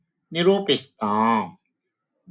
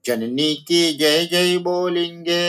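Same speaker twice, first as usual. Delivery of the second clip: raised, sing-song recital of the line; poem recitation; 7 semitones higher than usual. Shown as a demonstration. Teacher reciting the poem line to her students, sing-song. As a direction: chant the verse to the class.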